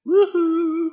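high hooted wake-up call; high sing-out, playfully imitating a yell; mock yelling. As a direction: holler teasingly from across a mountain. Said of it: "He starts yelling".

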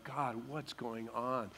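slow, low, hushed plea; broken and humbled. Acted. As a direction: ask God softly, as one humbled. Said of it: "he's broken and he's humbled".

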